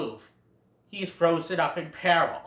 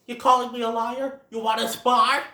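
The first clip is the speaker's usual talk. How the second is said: higher, louder taunting voice; mock tough challenge; 7 semitones higher, 4 dB louder than usual. A bully taunting Bambi, mock tough. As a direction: pick a fight with a sneer.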